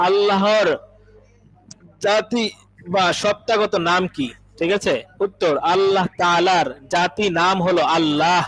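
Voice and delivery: slow, measured reading of a question and answer; reading a lesson text. Acted. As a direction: read it out deliberately for students to follow. Reads question and answer aloud.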